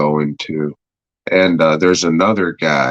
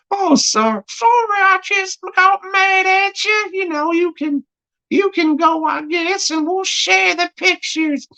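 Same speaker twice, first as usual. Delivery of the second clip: very high, squeaky put-on character voice; mock British apology. Acted fake apology in same character.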